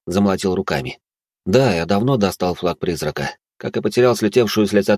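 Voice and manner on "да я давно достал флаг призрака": plain reading voice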